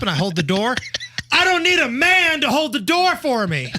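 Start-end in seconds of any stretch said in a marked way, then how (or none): 1.3-3.7 lightly raised, huffy woman's voice; mock indignant woman. Host retelling the McDonald's woman, mildly huffy. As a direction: toss it off with mock offense. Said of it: "woman coming up".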